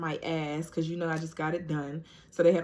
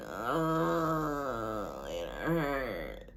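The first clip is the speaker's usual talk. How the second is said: imitating a moan; 6 semitones lower, 4 dB quieter, much slower than usual. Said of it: "she was just moaning in pain".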